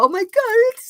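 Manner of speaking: a high, half-playful panicked cry; mock freak-out. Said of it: "I did kind of freak out and go".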